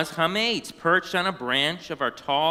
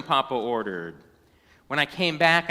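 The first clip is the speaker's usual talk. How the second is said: ordinary delivery, nothing marked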